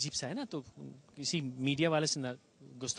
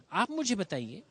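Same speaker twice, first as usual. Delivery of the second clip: normal talking voice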